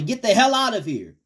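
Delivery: high, loud commanding rebuke; with authority. Shown as a demonstration. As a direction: order the devil out, no doubt allowed. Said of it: "you gotta say it with authority".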